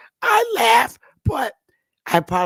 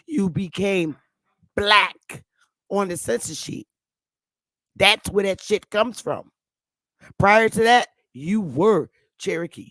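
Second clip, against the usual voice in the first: ordinary talk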